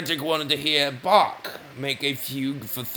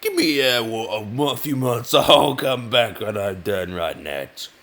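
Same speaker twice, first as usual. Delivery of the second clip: louder, raised voice with hemming and a cough; hesitant Bach; 7 dB louder than usual. Retold or imitated. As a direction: stall awkwardly like Bach asking for more time. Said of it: "Bach said".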